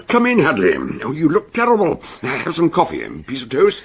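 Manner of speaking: high, loud, cheerful welcome; hearty greeting. Acted. Fell greeting the exhausted inspector, jovially loud. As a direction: boom a jovial hello over breakfast.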